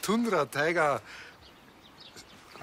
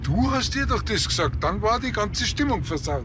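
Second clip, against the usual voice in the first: louder, much higher, indignant; defensive retort; 10 semitones higher, 4 dB louder than usual. Acted. Hubert fires back at Staller in the patrol car, indignant. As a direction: snap back at your partner.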